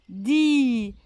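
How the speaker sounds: "Di" with a long held i; "Di" drawn out for a class; final sound stretched. Shown as a demonstration. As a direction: hold the vowel of "Di" to show the sound. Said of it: "C'est le son I".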